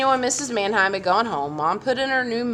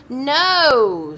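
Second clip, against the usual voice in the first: put-on loud high child's "No"; a child shouting no at parents; shouted; 6 semitones higher, 8 dB louder than usual. A narrator's reading. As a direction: shout it in protest.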